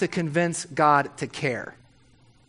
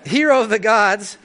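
amused mock proclamation; 5 semitones higher, 6 dB louder than usual. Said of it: "My spell check was like".

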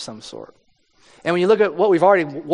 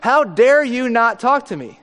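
higher, louder indignant demand; imitating an outraged king, raised voice; worked up Herod; 7 semitones higher, 5 dB louder than usual. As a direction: play Herod, offended at the silence. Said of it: "Herod's getting worked up at this point going".

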